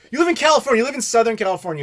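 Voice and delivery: exasperated scolding. Real, not acted. Scolding a viewer about pizza.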